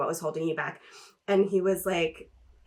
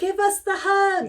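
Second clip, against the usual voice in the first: high, slow, begging chant; pleading demand; 10 semitones higher, 3 dB louder than usual. Pretend. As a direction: plead for it like a fan. Fans demanding the hug.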